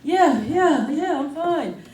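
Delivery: put-on high, strained kid voice, winded; out of breath kid. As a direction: gasp out a brave fine. Panting kid insists he's fine.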